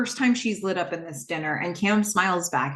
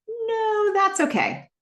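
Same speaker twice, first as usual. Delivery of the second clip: much higher, politely refusing; high reluctant decline; 14 semitones higher, slower than usual. Acted. Haley declining Joe's invitation, high and reluctant. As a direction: lift it high and brush it off.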